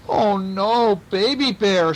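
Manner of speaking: a storybook "oh no" of worry; dismayed. A narrator's reading. Aunt finds Baby Bear is missing, dismayed.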